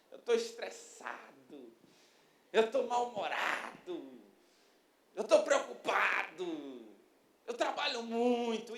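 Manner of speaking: slow, drawn-out whiny excuses; mock self-pity. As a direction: drag out each complaint, feigning suffering. Acted self-pitying excuses for quitting diet. (lamúria fingida, arrastando as palavras)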